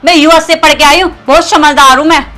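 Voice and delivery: loud, firm defiant retort. Acted. Young woman standing up to a harassing older man, defiant.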